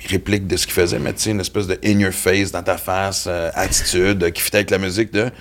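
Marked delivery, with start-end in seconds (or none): none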